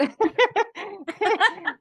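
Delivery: high, bubbling burst of laughter; real amused laugh. Real, not acted. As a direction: crack up at your own story. Laughing at the disappointing film.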